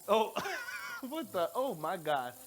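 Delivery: normal talking voice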